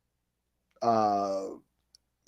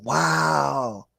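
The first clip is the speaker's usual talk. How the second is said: usual speaking voice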